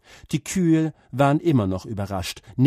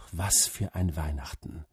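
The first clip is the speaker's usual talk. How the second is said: usual speaking voice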